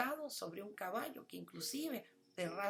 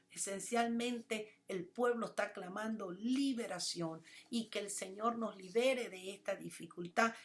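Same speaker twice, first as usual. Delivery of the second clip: ordinary talk, nothing marked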